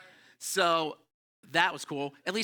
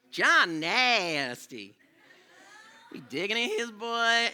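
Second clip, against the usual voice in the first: slow, a bit higher retelling of the schoolgirl line; lighter imitation of classmate; 6 semitones higher, 4 dB louder, much slower than usual. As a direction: repeat the tattle more loosely. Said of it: "that was how she talked".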